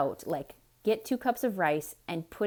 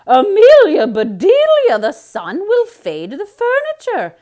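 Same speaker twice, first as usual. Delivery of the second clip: upset Missus Rogers; 12 semitones higher, 17 dB louder than usual. A narrator's reading. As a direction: wail at the maid in dismay. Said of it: "said Missus Rogers".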